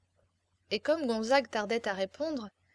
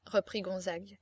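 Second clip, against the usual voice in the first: usual speaking voice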